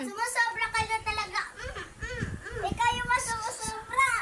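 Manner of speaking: high, heated accusation; angry. Pretend. Pretend quarrel escalating. (galit at mataas ang boses)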